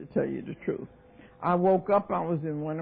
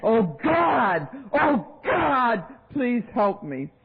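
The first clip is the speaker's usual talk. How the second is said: loud, high cry to God; re-enacted desperate scream for help; 7 semitones higher, 5 dB louder, much slower than usual. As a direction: scream out a desperate plea, easing at the end. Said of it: "And I started screaming".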